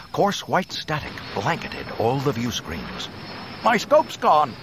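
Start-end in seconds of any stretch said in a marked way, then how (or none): 3.6-4.6 urgent pilot shout; yelling. Acted. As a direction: shout it over the noise. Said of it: "yelled Wedge".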